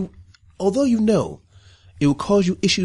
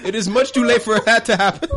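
higher voice breaking into laughter; laughing; 6 semitones higher, 4 dB louder than usual. Real, not acted. Game master answering a warning about accents, laughing. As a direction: get the words out through laughter. Cracking up over offensive accents.

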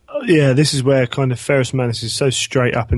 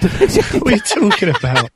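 laughing; 8 semitones higher than usual. Real, not acted. Laughing mock outrage.

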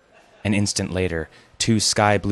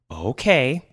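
higher, sugary singsong okay; too sweet; 6 semitones higher than usual. A narrator's reading. Daughter-in-law covering her coldness towards Grandpa, too sweet. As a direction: lay the sweetness on too thick. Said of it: "in her too sweet voice".

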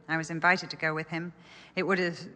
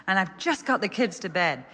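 a hint of a fed-up Israelite parent; weary parent; 3 semitones higher, 3 dB louder than usual. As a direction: parent groaning that the cloud moves again. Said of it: "And the parents would say".

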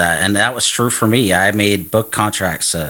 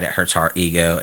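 ordinary talk, nothing marked

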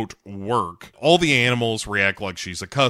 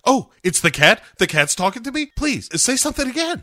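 put-on excited girl; 12 semitones higher, 4 dB louder, faster than usual. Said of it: "voicing Crystal excited".

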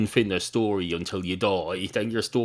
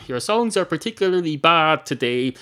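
much higher, louder furious yell; angry shout; 10 semitones higher, 7 dB louder than usual. Acted. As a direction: barman shouting down the harmonica. Barman yells at harmonica playing.

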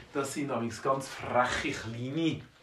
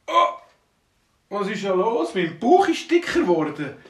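startled cry; 5 semitones higher, 8 dB louder than usual. Acted. Dwarf exclaims at torn trousers.

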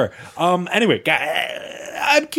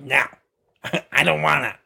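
imitating a friend's refusal; mock reluctant friend. Host mimics his friend refusing the party, reluctant. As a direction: put on a lightly mocking reluctant voice. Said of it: "that's what I talk like".

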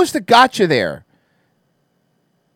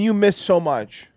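ordinary talk, nothing marked

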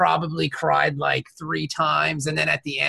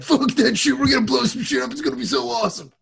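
higher, revved-up war cry; testosterone-fuelled mock battle yell; pumped-up rage; 9 semitones higher, faster than usual. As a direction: hype yourself into blowing things up. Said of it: "your testosterone rage".

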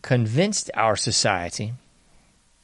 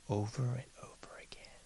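fading unvoiced whisper; whispered trail-off; 18 dB quieter than usual. Real, not acted. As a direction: let it die to a whisper. Hushed trailing aside.

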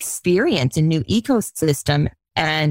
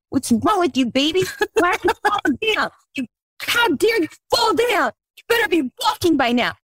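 much higher, mock stern scolding; harsh scolding parent; 11 semitones higher than usual. Parent scolding a baby learning to walk, mock harsh. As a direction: scold the toddler in a hammed-up way. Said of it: "do we say".

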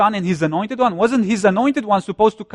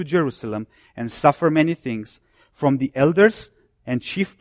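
lower, measured Bible reading; solemn reading of scripture; 4 semitones lower than usual. Acted. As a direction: read the verse with weight. Reads scripture passage aloud.

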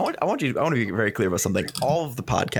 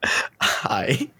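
whisper. Real, not acted. Whispered greeting.

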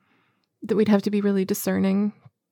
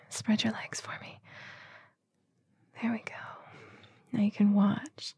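soft intimate whisper; 8 dB quieter than usual. Real, not acted.

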